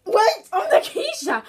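high laughing realization. Real, not acted. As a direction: laugh at your own mistake. Realizes she misnamed herself, laughing.

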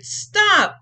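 a loud fed-up father; Papa cries out. Acted. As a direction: call out as an exasperated dad. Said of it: "Papa cried".

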